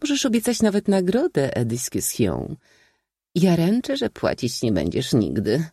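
high mocking lady's voice; teasing princess. A narrator's reading. Princess mocking Iza's promise, teasing high voice. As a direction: needle the young man playfully.

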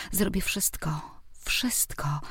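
breathless whispered vow. A narrator's reading. Heroine answering Kuba's invitation, whispered vow. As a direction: whisper it as a secret longing.

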